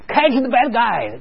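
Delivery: high, slow, stretched-out game cry; high pretend-police rallying call; playful chase call. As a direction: draw out the call to lure a child into play.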